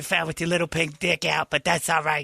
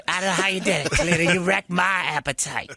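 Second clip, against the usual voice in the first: ordinary talk, nothing marked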